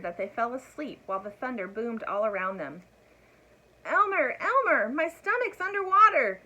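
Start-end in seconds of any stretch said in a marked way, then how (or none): 3.8-6.4 high, loud alarmed call; crying out. A narrator's reading. Dragon waking Elmer in alarm, high and loud. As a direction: wake him with a cry. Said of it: "cried the dragon".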